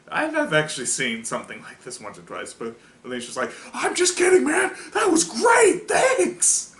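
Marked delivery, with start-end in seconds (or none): 3.7-6.7 very high, loud excited squeal; cartoonishly thrilled, pitched way up; hammed-up delighted shout; gleeful mock cheer. Retold gleeful reveal, acted.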